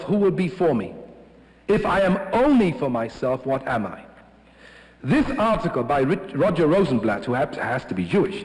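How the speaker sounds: usual speaking voice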